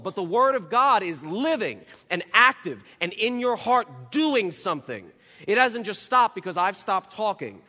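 sermon climax, impassioned; full-force preaching. Acted. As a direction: preach it with building fire.

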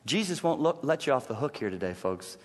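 normal talking voice

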